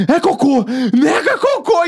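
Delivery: high-pitched mock horror; imitated horrified realisation. Friend realises what was thrown at him, high horrified cry. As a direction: play the friend discovering what hit him. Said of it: "Daí ele".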